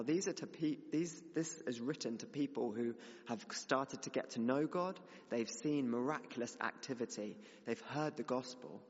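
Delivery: ordinary talk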